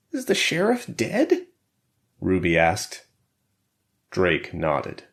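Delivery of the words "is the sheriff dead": very high, small questioning voice; anxious character. A narrator's reading. Wounded Ruby asks about the sheriff, anxious.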